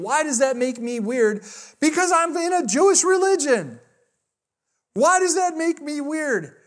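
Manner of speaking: high, slow calling across a room; re-enacted indignant yell; retold yelling. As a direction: protest it high and drawn out. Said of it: "I keep yelling at her".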